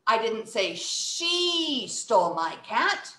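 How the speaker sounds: SHE stretched long; slow, with "she" drawn out; stress on SHE. Shown as a demonstration. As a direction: make "she" the word that matters. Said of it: "Now it's talking about who".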